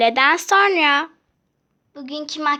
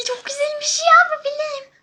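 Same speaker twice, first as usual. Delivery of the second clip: much higher, pleased squeaky voice; high delighted voice; 10 semitones higher than usual. Pretend. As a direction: coo over your new necklace. Admires the stolen necklace.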